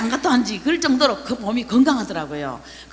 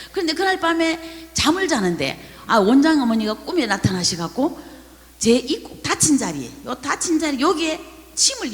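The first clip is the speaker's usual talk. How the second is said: normal talking voice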